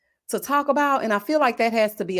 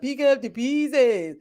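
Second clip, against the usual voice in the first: imitating Martin; 3 semitones higher, slower than usual. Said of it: "Talking about".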